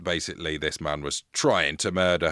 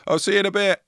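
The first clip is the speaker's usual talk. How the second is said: loud, higher call to nobody inside; shouting back into the house; 13 semitones higher, 7 dB louder, faster than usual. Retold or imitated. Leaving the house, calling to a pretend housemate, loud and high. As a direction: fake a housemate to scare burglars. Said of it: "shout as if to someone".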